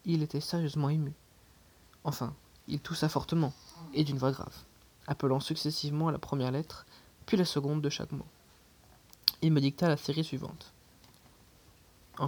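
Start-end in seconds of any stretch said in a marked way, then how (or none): none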